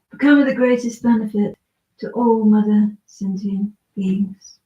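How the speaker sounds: ordinary talk, nothing marked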